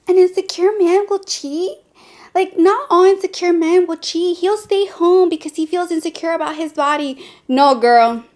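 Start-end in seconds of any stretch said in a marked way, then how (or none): 0.1-7.2 higher-pitched mock protest from a naive listener; doubting objector. Viewer objecting that not all men cheat, higher mock protest. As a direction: imitate a doubter, pitched up and questioning.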